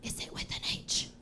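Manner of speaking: whispered, grave and intimate; whispered question. Pretend. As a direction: whisper it like a secret. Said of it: "I ask that woman".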